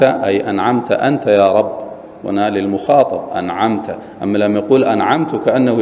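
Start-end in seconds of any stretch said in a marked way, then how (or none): none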